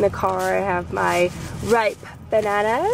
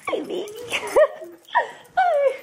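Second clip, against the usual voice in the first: doggy voice. Real, not acted. Greeting the dog Dee, high squeaky baby talk. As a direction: coo a hello to the puppy.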